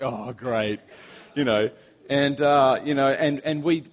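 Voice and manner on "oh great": low, muttered sarcastic "Oh, great.". Retold or imitated. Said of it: "It's like".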